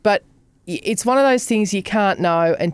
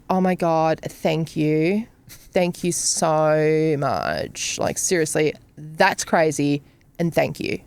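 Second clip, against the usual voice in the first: soft, slow, lower, overwhelmed thanks; effusive gratitude; 5 semitones lower, 3 dB quieter, much slower than usual. Retold or imitated. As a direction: gush gratefully, hushed and drawn out. Son's grateful text retold.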